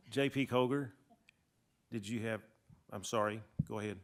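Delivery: ordinary talk, nothing marked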